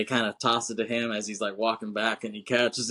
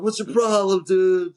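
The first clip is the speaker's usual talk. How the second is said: loud, high, indignant shout; angry yell; 8 semitones higher, 7 dB louder than usual. Retold or imitated. Surfers confronting Johnny after the tackle, shouting. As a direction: shout it in his face, offended.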